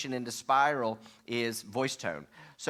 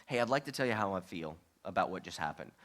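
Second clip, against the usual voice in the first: softer, lower, calm honest tone; gentle complaining; 3 semitones lower, 4 dB quieter than usual. Shown as a demonstration. Spouse calmly sharing hurt feelings, soft and low. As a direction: tell them gently how you feel. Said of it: "here's complaining".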